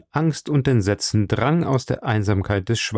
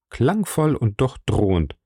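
usual speaking voice

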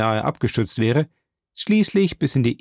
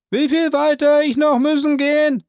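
high, loud servant voice calling down; Jupiter's voice; 5 dB louder than usual. Acted. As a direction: pitch him well up and call out.

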